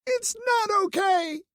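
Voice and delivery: high, slow tearful yell. A narrator's reading. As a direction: throw it back at him. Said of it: "she yelled".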